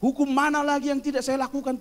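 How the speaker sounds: lightly acted self-assured appeal; rich young man. Rich young man answers the Lord, protesting. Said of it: "pemuda yang kaya itu, dia bilang".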